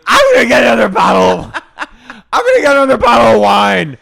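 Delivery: high, loud, fast wailing shout; whiny boozy outburst with a laugh; drunk Miranda. Retold or imitated. Miranda insisting on another bottle, loud wailing voice. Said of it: "Miranda goes".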